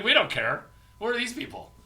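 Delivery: loud, high carefree brush-off; loud mock dismissal. Partiers brushing off important guests, loud brush-off.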